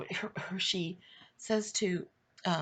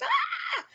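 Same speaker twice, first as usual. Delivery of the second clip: high squealing fangirl shriek through laughter; mock fan scream; 5 dB louder than usual. Cousins spotting [name] in the house, mock fan scream. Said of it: "started screaming their heads off".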